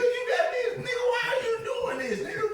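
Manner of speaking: very high, loud, laughing squeal; retold frantic protest. Inmate protests to workout partner in weight room, mock frantic shout. Retold panic at possible fight.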